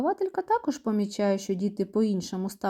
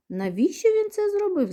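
high-pitched surprised question; astonished; 11 semitones higher than usual. A narrator's reading. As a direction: ask in storybook astonishment, voice lifted high. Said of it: "здивувалися друзі". (здивовано, високим голосом)

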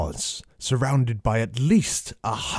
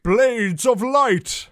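drawn-out high battle cry; spell shout; 10 semitones higher, slower than usual. Acted. Marcus casts his spell against the charging Tauren, shouted high. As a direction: call the spell slowly and higher.

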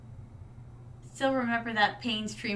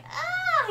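very high mock "ah" of alarm; retold startled scream. Streamer acting out being hit by a ball, high mock scream. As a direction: squeal as if struck, in play. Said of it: "I'm like".

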